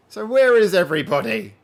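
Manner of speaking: loud, high call to absent viewers. Real, not acted. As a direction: call out loudly for everyone. Calling out to missing viewers.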